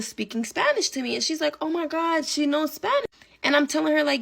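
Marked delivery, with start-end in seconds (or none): 1.6-3.1 higher, gushing mock surprise; mock amazed bestie. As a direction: lightly play the friend's delighted surprise. Bestie gushing about Zola.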